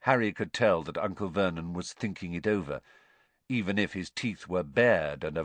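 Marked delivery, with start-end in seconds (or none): none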